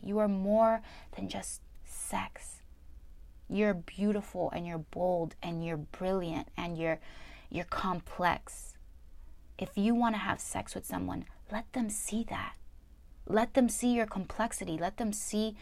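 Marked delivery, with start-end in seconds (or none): 0.0-2.6 whisper. Real, not acted. Whispering to women that they are more than sex. Soft advice to viewers. 11.5-14.8 whisper. Real, not acted. Soft advice to viewers.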